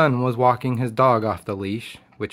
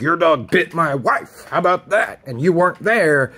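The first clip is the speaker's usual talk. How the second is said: mock angry accusation; 6 semitones higher, 4 dB louder than usual. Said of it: "He's like".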